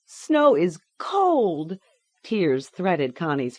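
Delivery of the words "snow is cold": slow, high and trembling; tearful child. A narrator's reading. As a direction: drag the words out through tears. Connie reads truth slowly.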